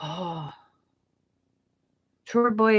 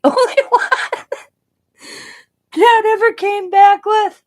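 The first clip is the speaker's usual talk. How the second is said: loud, laughing through the words; laughing hard, much louder; 12 semitones higher, 10 dB louder than usual. Retold or imitated. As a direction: retell it while cracking up. Doctor laughing at her answer.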